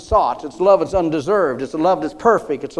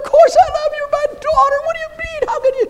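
high mock wife voice; 3 dB louder, faster than usual. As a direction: pitch way up, imitating the wife. Quoted wife, high put-on voice.

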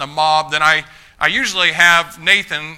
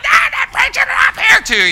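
much higher, louder throat shout; 6 dB louder than usual. Shown as a demonstration. Mocking preachers who shout up high in the throat. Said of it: "it sounds a little more masculine".

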